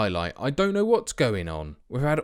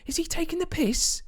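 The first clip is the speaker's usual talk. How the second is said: much higher, squeaky mock outrage; incredulous retort. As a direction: play up the disbelief. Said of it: "And I'm saying".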